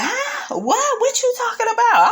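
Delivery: high, baffled squeak; mock clueless Wendy. Imitating Wendy playing dumb about business, high squeak. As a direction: play dumb, all confusion, way up high.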